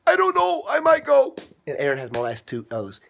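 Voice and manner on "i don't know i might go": high, dithering toy voice; undecided voice. Acted. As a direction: hedge like you can't decide. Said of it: "Right, Grumpy O?".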